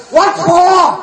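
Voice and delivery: lifted high, loud and baffled; high puzzled American. Retold or imitated. American confused by the numbered thank yous, squeaky puzzled high voice. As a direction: play the confused American, pitch way up. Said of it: "Mỹ nói".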